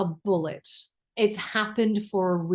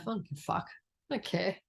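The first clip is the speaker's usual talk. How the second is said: much softer, throwaway I don't care; muttered dismissal; 10 dB quieter, much faster than usual. Real, not acted. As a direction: drop it to a shrug under your breath. Dismissing unkind people.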